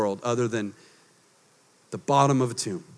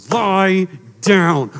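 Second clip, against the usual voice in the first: Jesus telling sheep; 3 semitones higher, 5 dB louder than usual. Pretend. Jesus ordering a sheep, mock firm command. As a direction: tell the sheep to lie down, firmly. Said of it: "Jesus with a sheep going".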